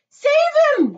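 urgent mother's cry; panicked cry. A narrator's reading. Mother cries for her drowning boy, panicked. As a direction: cry out for rescue. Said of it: "cried Missus Gloop".

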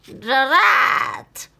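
loud, high witch curse; angry witch. Acted. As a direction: spit it out in frustration. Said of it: "said the witch".

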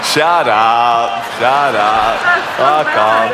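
telling the laughing crowd to pipe down; jokey snap at the crowd. Real, not acted. To the crowd laughing too hard, mock-annoyed.

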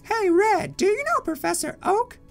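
put-on high, bright-pitched kid voice; young Pokemon character.

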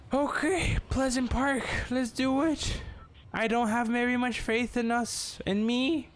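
jittery high-pitched Morty impression. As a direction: squeak it out like Morty from Rick and Morty. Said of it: "And I sound like Morty".

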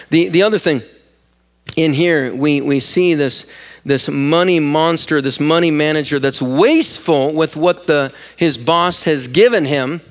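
normal talking voice

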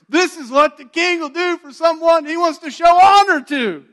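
high, loud herald's cry through the streets; a loud, high proclamation shouted to the crowd; booming royal proclamation. Retold or imitated. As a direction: announce it to the whole city.

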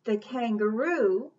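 ordinary delivery

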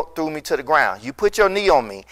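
ordinary talk

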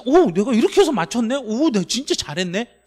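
high, loud mock amazement at yourself; excited self-praise. Shown as a demonstration. Teacher acts a student delighted at solving a problem, excited self-praise. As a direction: cheer yourself on, delighted and surprised. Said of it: "진짜 재밌어야".

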